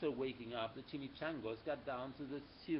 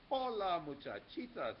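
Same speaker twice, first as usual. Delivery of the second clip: ordinary delivery, nothing marked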